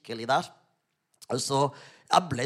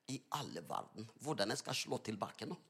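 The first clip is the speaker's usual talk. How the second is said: much softer, lower aside; quiet inner thought; 5 semitones lower, 12 dB quieter than usual. Retold or imitated. Preacher wondering how to answer back, quiet inner thought. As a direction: think it aloud under your voice.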